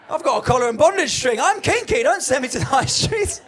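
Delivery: hammed-up wounded pride; mock indignant shout. Comedian to sex shop assistant, mock outraged shout.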